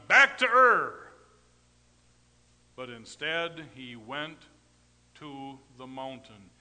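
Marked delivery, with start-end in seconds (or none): 0.1-1.1 loud, high, drawn-out outburst; mock defiant shout. Preacher imagining Abraham refusing God, mock defiant shout. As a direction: belt it out as a hammed-up refusal.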